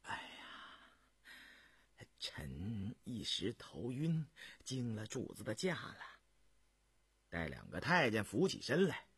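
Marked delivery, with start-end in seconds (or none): 0.0-6.0 soft, slow, low murmur; breath-only sigh, then hushed apology; embarrassed, weak apology. A narrator's reading. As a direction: apologise faintly, just come round from a faint. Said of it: "不好意思地说道".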